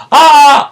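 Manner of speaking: very high, loud acted scream; Jay's falling scream. Villain's head falling over the balcony, high loud scream. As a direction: scream out as if plummeting.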